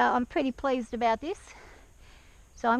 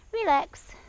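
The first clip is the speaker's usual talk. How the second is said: ordinary talk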